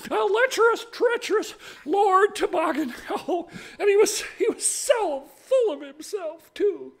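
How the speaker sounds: high, loud gushing with hammed-up disgust; relieved, disgusted lady. Lady thanks her rescuer and reviles the groom, high gushing. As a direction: gush thanks and pile up insults in a high voice.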